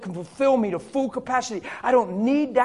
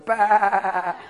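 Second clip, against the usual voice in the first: light laughing baa; sheep bleat. Shown as a demonstration. Preacher showing a sheep's bleat to the mirror, laughing. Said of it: "Put it right on your mirror".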